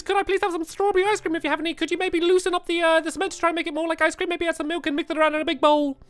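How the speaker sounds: high, fast customer gabble; speaking really fast. Shown as a demonstration. Customer gabbling an order at the ice cream counter, high and fast. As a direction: race through the order at top speed. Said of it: "Speak really fast".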